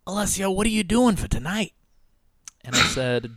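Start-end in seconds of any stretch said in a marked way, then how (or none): 0.1-1.7 put-on high, sing-song older woman; Joan's voice. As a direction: ask it like a nosy older lady. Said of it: "she goes".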